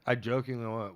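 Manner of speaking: ordinary talk, nothing marked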